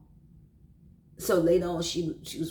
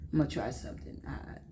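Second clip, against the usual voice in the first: ordinary delivery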